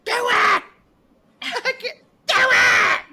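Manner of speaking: high, strained panicked wail, hammed up; mock panicked cry. Mocking the guy who can't cut off the arm, strained panicked wail. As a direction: camp it up like he's losing his nerve. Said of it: "first he's like".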